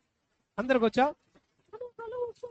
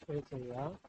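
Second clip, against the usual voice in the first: put-on slow, softer prayer line for the child to repeat; leading a prayer; 6 semitones lower, 9 dB quieter, much slower than usual. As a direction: pray it slowly so the child can follow. Adult leads prayer. (నెమ్మదిగా, మెత్తగా ప్రార్థన)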